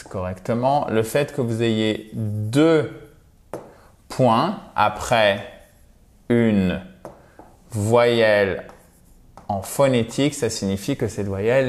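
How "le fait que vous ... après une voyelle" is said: ordinary delivery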